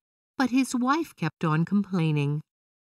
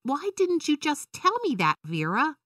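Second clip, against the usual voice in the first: put-on indignant child; 10 semitones higher than usual. A narrator's reading. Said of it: "Violet asked".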